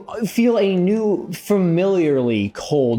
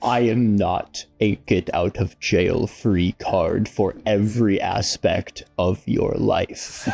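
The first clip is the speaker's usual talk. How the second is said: low gravelly patron voice; 6 semitones lower, slower than usual. Acted. Patron voice continues, sassing.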